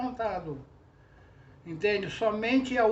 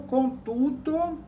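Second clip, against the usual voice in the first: contudo said slowly and higher, stretched out; drawn-out contudo; 6 semitones higher, 4 dB louder, much slower than usual. Shown as a demonstration. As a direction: stretch out contudo to show the rhyme. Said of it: "rimando com seu telefone está mudo". (contudo alongado e mais agudo)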